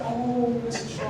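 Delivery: put-on high, soft, cooing old lady; precious older-lady voice; sweet grandmotherly receptionist. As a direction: coo sympathetically like a nice grandma. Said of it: "kinda like a grandmother, just really nice".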